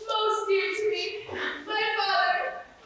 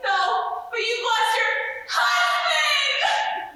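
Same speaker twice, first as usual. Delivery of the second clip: slow, high, shouted mock grief; hammed-up shout; 8 semitones higher, much slower than usual. Maid breaking fake news to the wife with a slow mock shout. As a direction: bellow the bad news, slowly. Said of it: "broken-hearted lamentations".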